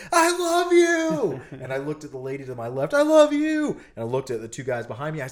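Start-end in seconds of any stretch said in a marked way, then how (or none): 0.1-1.4 very high, loud, stretched-out scream; retold scream. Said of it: "I just screamed dead in her face". 2.9-3.8 high, loud cry of love; retold scream. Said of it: "I just screamed dead in her face".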